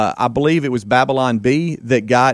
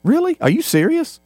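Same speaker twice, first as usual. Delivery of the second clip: surprised. Retold or imitated. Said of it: "he looked at me like".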